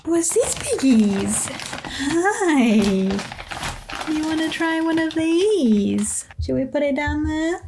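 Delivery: high, cooing voice for pets; pet talk. Real, not acted. Talking to her guinea pigs.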